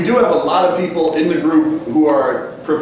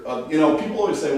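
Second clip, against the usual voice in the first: normal talking voice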